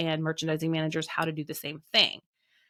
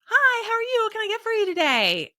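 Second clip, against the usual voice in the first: much higher and louder, bubbly service greeting; chirpy Midwest barista; 4 dB louder than usual. Retold or imitated. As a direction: sing out the greeting like an overly friendly barista. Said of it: "the barista is, of course, like".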